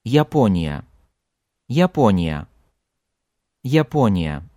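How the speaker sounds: Япония with a full Ya at the start and a weak, reduced ya at the end; Япония: clear Ya first, faint end; reduced final Ya. Shown as a demonstration. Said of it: "Just listen how I pronounce Japan".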